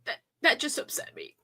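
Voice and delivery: choked-up, real tears, soft and quiet; fighting back real tears, down to a near-whisper; teary. Real, not acted. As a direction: voice breaking, trying to hold it together. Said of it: "I'm getting teary again".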